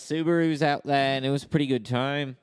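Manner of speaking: put-on improvised Aussie storyteller accent; Australian accent. Australian recounting an outback road trip, Australian accent. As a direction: ramble on as an Australian mate. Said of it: "Australian accent".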